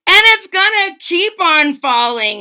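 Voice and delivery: put-on high, loud boyish shout. A narrator's reading. Boy shouting at the king about the falling Ublek. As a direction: yell it at the king. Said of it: "he shouted".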